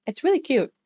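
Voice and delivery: usual speaking voice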